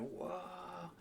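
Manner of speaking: high, soft whoa of wonder; awed onlookers. Acted. People watching the airship float down, awed. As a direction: let out a hushed, amazed whoa. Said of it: "And people are going".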